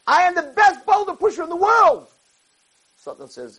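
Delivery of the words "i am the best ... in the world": very high, crowing cry; exultant yell. Acted. Yaakov crowing to Satan about being best, exultant high yell. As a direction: peak of a boast, voice pitched way up.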